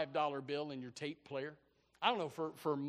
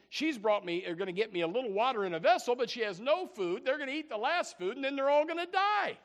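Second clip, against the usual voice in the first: rising higher and louder toward panic; building despair; 9 semitones higher, 8 dB louder than usual. Acted. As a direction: climb from worry to alarm. Builds to a desperate climax.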